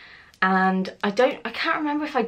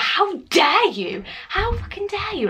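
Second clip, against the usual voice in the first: high-pitched, drawn-out indignation; mock furious; 12 semitones higher, slower than usual. Fuming at her friend over family dinner, mock furious. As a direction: act out outrage, pitch swooping high. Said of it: "getting really furious".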